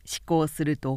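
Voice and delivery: plain reading voice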